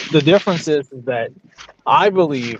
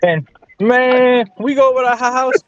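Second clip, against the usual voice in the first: talking like Ricky; 9 semitones higher than usual. Retold or imitated. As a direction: spin a down-home yarn as Ricky. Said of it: "that's all you gotta do to be Ricky".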